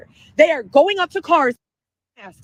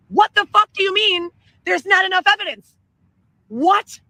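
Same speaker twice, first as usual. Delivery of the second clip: angry; 6 semitones higher than usual. Real, not acted. Ranting about police inaction.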